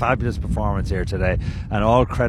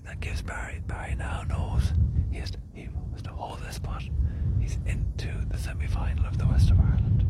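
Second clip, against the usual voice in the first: whispering; 6 dB quieter than usual. Real, not acted. Hushed golf commentary beside green.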